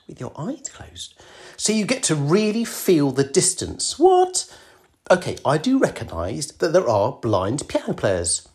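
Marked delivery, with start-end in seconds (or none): 4.0-4.5 sharp high disbelieving yelp; startled, almost squeaked what; incredulous what. Real, not acted. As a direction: jump up in pitch, baffled. Reacting to eyes-closed advice.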